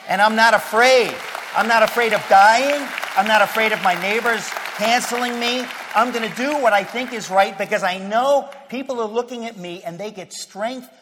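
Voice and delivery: loud, defiant pulpit declaration; fiery preaching. Acted. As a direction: proclaim it boldly. Declaring fearlessness.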